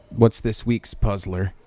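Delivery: usual speaking voice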